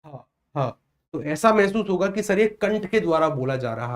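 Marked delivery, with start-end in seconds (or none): 0.1-0.7 हा said softly from the throat; throat sound demo. Shown as a demonstration. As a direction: breathe out हा, हा from the throat. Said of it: "बोलकर देखिए हा".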